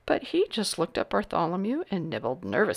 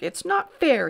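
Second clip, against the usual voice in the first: indignant cry; 10 semitones higher than usual. A narrator's reading. Grand Duke giving up on the hat, indignant cry. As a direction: repeat the complaint in a boyish whine. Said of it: "cried the Grand Duke".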